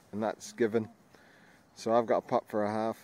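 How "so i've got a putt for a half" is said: usual speaking voice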